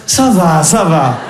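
a low, dragged rasp of a weary mother; mother's rasping groan. Retold or imitated. Son phones, mother answers with a low guilt-laden rasp. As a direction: groan it out like a guilt-tripping mum. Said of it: "Elle a un râle".

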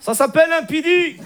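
put-on high Moroccan-accented retort; Hicham. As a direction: answer back in a thick Moroccan accent. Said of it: "l'accent arabe".

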